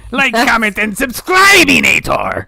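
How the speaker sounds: high, loud, slow cartoon villain; Doofenshmirtz voice. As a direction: belt it like a mad cartoon scientist. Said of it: "comment, subscribe in the Doofenshmirtz voice".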